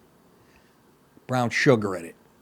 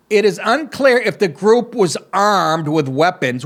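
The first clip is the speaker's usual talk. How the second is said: mock anchor reading the story; deadpan read of the news copy; 4 semitones higher, 4 dB louder, slower than usual. Host reading the heist story to listeners, mock news read.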